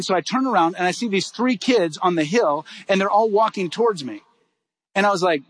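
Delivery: ordinary delivery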